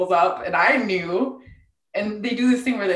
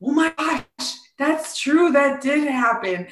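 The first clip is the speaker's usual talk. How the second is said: mock amazement; 6 semitones higher, slower than usual. Acting her own amazed reaction.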